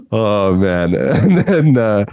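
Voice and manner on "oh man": ordinary talk, nothing marked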